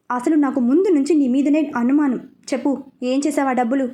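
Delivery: plain reading voice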